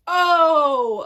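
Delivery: loud, high, drawn-out stall; mock caught-out "oh". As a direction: stretch it out like a caught cheater. Imitating caught boyfriend stalling.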